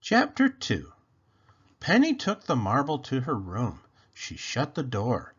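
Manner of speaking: ordinary delivery, nothing marked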